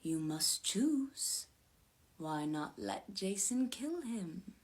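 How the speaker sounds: slow, soft, sinister whisper; hushed and drawn-out. A narrator's reading. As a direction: whisper it slowly, like a tempting voice in the ear. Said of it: "Gaia seemed to be whispering to her".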